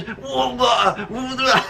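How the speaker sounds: strangled, wordless gurgle; high, choked-off mock babble; imitated choking. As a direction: garble it as if held in a headlock. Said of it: "'Cause I'm choking this guy".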